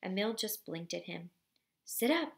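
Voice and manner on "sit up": higher, louder repeated order; father repeats the order even louder. A narrator's reading. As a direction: raise it up another notch. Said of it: "Papa said even louder".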